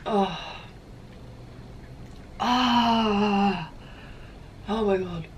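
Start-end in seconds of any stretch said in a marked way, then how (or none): 2.4-3.7 pained gasp. Real, not acted. Gasping after hot spice.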